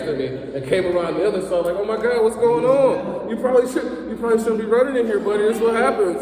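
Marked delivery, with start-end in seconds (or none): 1.8-6.2 high, loud mock-innocent bystander; fake innocent concern. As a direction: play the sweet innocent after the trip. Fake concern after tripping kid.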